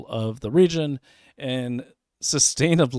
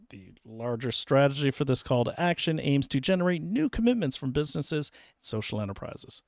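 usual speaking voice

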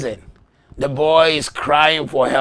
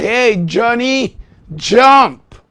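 high, loud, drawn-out call; acted yell; 10 semitones higher, 10 dB louder, much slower than usual. Man in the crowd calls to his son, yelling. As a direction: yell it slowly up to the boy. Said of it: "a man shows and yelled the boy's name".

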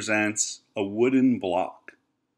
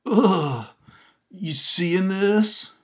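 awkward bystander; 9 semitones higher, 4 dB louder, much slower than usual. Retold or imitated. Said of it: "You're just standing there like".

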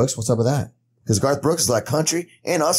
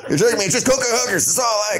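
higher, laughing mock shout; mock indignant; 8 semitones higher, faster than usual. As Sheen, laughing indignant shout at friends. Caught out while playing Sheen.

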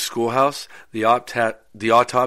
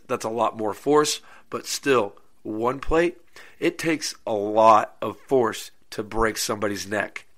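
usual speaking voice

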